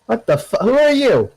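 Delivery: high-pitched, startled thug; alarmed who-goes-there, voice shooting up; startled challenge. Acted. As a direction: jump and challenge the intruder. Startled gangbanger answers intruder's shout.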